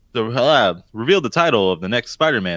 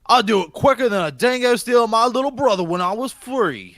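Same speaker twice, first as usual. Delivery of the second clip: higher, put-on Australian accent; hammed-up Aussie voice; 10 semitones higher than usual. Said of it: "your Australian accent".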